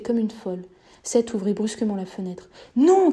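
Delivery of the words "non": sharp, much higher and louder no; cry out. Acted. Kendra shouting at Seth not to open the window, loud. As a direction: shout it to stop him. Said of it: "cria Ken-, Kendra".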